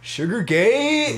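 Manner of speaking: slow, high, drawn-out announcement; mock title call. Real, not acted. Naming the dumb episode topic, slow and high. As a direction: stretch it like a scandal headline.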